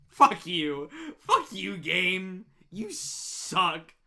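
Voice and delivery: loud furious yelling at the game; shouting curses at the screen; real rage. Real, not acted. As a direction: blow up at the game. Raging at the game.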